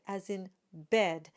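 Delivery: ordinary delivery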